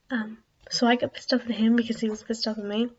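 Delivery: usual speaking voice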